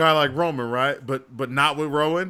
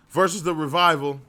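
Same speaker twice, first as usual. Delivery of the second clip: normal talking voice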